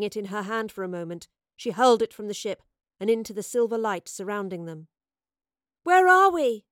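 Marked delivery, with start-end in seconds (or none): 5.8-6.7 high, loud shout over the roar; calling above noise. A narrator's reading. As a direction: yell the question over a din. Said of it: "Eowyn called above the noise".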